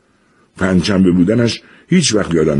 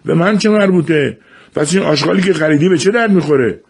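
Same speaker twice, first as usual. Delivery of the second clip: put-on defiant boy yelling higher; shouted back; 12 semitones higher than usual. A narrator's reading. Jafar yells back at his father, defiant. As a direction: yell it back while running off. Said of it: "جعفر پرید بیرون و داد زد".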